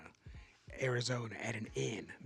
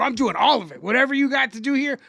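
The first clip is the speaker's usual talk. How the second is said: louder, higher-pitched eagerness; mock gung-ho; 6 semitones higher, 5 dB louder than usual. Guest baiting the innkeeper, playfully over-keen. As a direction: announce it like you're up for anything.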